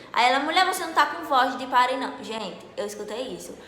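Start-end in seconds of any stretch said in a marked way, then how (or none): 0.4-2.2 higher, louder mock nurse voice; imitating dismissive nurse. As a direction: act out the nurse brushing her off. Imita a enfermeira que a dispensa. (imitando a enfermeira, tom alto de desdém)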